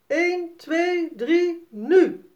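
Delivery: hoog aftellen, luider naar nu; aftellend roepen. A narrator's reading. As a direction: tel af voor de start en knal nu eruit. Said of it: "roept Sannes moeder". (moeder roept het startsein van de race)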